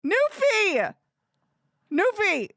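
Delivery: high, loud call of a name. Real, not acted. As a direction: cry out the name in high-pitched exasperation. Calling out the character's name.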